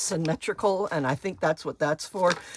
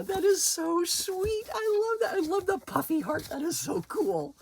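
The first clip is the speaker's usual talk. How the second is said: delighted gushing; 12 semitones higher than usual. Real, not acted. Thrilled by a friend's gift.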